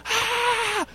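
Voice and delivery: mock high-pitched delighted shriek; kids' shriek. Imitating the kids shrieking at the Ferrari, very high. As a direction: squeal like thrilled kids. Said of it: "And they're like".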